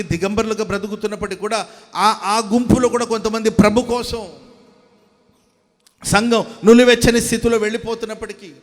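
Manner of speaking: loud, driving sermon delivery; preaching at full force. Acted. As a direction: preach it out to the whole congregation. Preacher builds to a loud exhortation. (బిగ్గరగా ఉద్రేకంతో ప్రసంగం)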